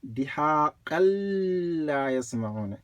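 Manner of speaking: "دِهَاقًا" runs into a long held L of "لَا"; joined shadda. Shown as a demonstration. Teacher showing joined words, long doubled L into "لَا". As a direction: join "دِهَاقًا لَا" through a stretched, doubled L. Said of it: "by doubling, as we did right here".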